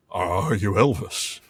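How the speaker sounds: slow, low lightly voiced [name]; a hint of [name] drawl. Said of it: "I will never do an [name] impression again".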